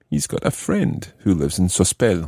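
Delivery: normal talking voice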